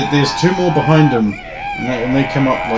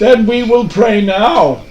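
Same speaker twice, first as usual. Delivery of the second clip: higher, slow solemn defiance; hammy character voice; 7 semitones higher, slower than usual. Acted. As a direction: declare it like a stubborn zealot. Priest NPC defiant.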